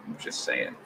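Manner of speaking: breathy whispered aside. Shown as a demonstration. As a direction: whisper it under your breath. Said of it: "So I'm just saying".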